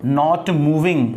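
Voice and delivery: not moving drawn out word by word; very slow. Shown as a demonstration. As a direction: spell out the meaning slowly. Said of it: "stationery का मतलब होता है".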